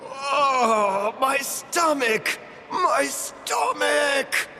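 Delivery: slow, drawn-out groan of pain; pained wailing. Acted. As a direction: wail as if clutching an aching belly. Crying out over stomach pain.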